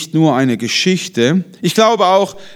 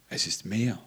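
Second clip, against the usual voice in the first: soft echo; 9 semitones lower, 14 dB quieter than usual. Shown as a demonstration. Same words echoed much softer.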